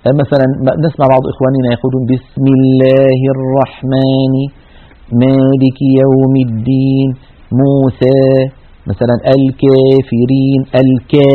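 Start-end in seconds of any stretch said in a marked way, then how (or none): 2.1-8.2 slow recitation with the alif of مالك and موسى leaning toward ya; slight imala. Shown as a demonstration. Teacher imitates the common error, alif tilted toward ya. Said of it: "الألف الممالة إمالة صغرى". 9.4-10.6 الكافرين with the alif bent toward ya, kefirin; tilted alif. Shown as a demonstration. Teacher demonstrates the wrong, tilted alif. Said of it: "كيف الصوت مائل".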